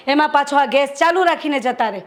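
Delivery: normal talking voice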